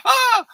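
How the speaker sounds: loud, high-pitched yell; retold father's sudden scream; short burst of acted screaming; mock scream. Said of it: "he's screaming at me".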